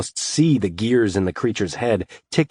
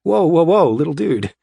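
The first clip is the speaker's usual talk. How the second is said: surprised; 9 semitones higher, 5 dB louder than usual. Acted. Calming startled kobold.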